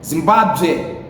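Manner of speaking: Zimbabwe said zim-ba-zwe, set apart in a different voice; Tonga pronunciation. Shown as a demonstration. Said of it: "we say Zimbabwe".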